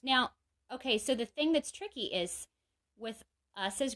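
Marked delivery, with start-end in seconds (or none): none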